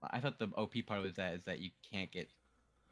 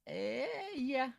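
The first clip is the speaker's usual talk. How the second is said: squeaky yeah. Real, not acted. Squeaky reluctant answer.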